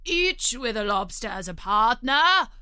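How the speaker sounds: loud, eager Gryphon; excited cry. A narrator's reading. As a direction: cry it out with a lifted ending. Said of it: "cried the Gryphon".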